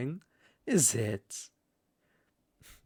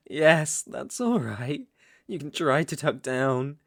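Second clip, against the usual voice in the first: ordinary delivery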